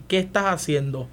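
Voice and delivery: ordinary delivery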